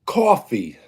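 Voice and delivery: "Coffee" in a New York accent, as cawfee; New York "Coffee" with a rounded aw vowel. Shown as a demonstration. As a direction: say "Coffee" the Brooklyn, Long Island way. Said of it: "That's how I say".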